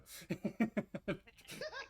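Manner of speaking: high-pitched, drawn-out giggle. Real, not acted. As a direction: giggle at your own joke. Amused at own character choice.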